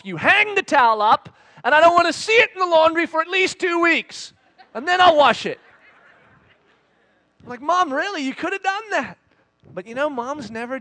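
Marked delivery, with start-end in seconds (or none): 0.0-5.6 high, loud mock scolding; hammed-up stern mother; mom laying down rules. Imagined mom laying down towel rules. 7.6-9.2 high-pitched pleading protest; acted son's baffled complaint; mock exasperation. Imagined protest to mom.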